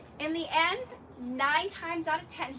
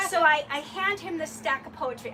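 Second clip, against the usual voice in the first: normal talking voice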